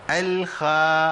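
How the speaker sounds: الخا said with a smile, thin kha, drawn out; slow wrong demo of الخا; smiling thin kha. Shown as a demonstration. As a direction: say الخا with spread lips, too light. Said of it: "أنا عملت ابتسامة".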